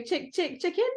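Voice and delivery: playful chicken buzzer noise; clucking chicken buzzer. Pretend. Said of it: "I've got a bit of a croaky voice".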